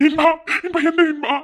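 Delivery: high anxious shout; shouting his name. Acted. Friend shouting at hurt Inba, worried shout. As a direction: call out to him in worry.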